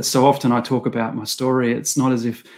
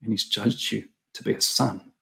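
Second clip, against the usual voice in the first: friend's gentle reply; 4 dB quieter than usual. Retold or imitated. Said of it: "And he goes".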